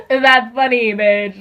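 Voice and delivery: high, loud burst of laughter; laughing hard. Real, not acted. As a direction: crack up in disbelief. Reacting to the mom's news.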